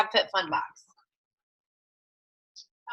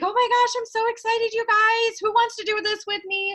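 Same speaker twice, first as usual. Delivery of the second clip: much higher, giddy new recruit voice; Molly thrilled; 10 semitones higher, 3 dB louder than usual. Acted. New recruit telling her live viewers she's excited, giddy. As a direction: act like a beginner bursting with excitement. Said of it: "And Molly's like".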